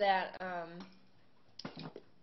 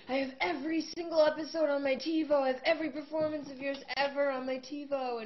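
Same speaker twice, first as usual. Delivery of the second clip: higher and louder, eager rush of fandom; gushing fan; 7 semitones higher, 6 dB louder than usual. Retold or imitated. Fan gushing about his TiVo collection, eager and loud. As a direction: rave like an obsessed superfan.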